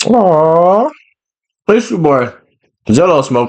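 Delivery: talking around ice in mouth; mouth full. Real, not acted. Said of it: "I got ice in my mouth right now".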